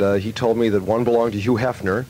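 ordinary talk

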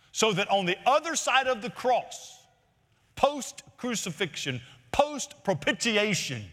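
fervent preaching. Acted. Pastor to congregation about true churches, preaching at full force. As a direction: press every phrase home like a revivalist.

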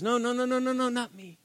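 acted hasty brush-off; quick denial. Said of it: "he quickly says".

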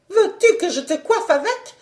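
high-pitched, menacing retort; threatening wife. A narrator's reading. Terrible wife threatens her husband over the dish, high menacing voice. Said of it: "menaça la terrible Doxy".

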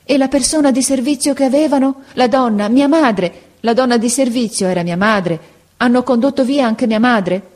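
rising panic over his mother; anguished boy pleading; alarmed. A narrator's reading. Boy learns mother taken away.

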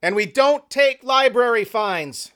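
high, slow heckling call; shouted from the back. Acted. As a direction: call it across the room, stretched out. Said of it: "Jerry shouted from the back".

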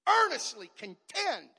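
loud, slow pulpit call; a slow shouted charge; preached exhortation. Acted. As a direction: ring it out to the church.

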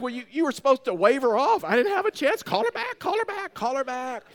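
high, frantic, hammed-up pleading; retold panic. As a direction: act out a dad panicking over a backfired prank. Panicked dad in retold story.

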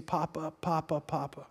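put-on very soft, low, hurt-child murmur; hushed plaintive repeated call; understated imitation of crying. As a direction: mimic a tearful toddler being held, hushed. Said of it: "she cries and cries and keeps saying".

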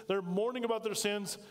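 usual speaking voice

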